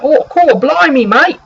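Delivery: put-on high, loud, thrilled Cockney street kid; Cockney accent. Street boy Mickle greeting the party, high excited Cockney yelp. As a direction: blurt it like a delighted London urchin. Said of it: "he's got a real Cockney accent".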